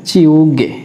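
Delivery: "চিউগে" starting with a ch sound; ch pronunciation. Shown as a demonstration. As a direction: model "চিউগে" for learners with voiceless ch. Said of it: "জিউতের উচ্চারণটা হবে চর মতো".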